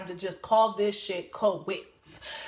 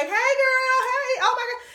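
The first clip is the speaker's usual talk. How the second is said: high, bright gushing fake friendliness; high-pitched phony girl greeting; fake nice greeting; 5 dB louder than usual. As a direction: gush over her like a fake friend. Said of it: "she over there being nice".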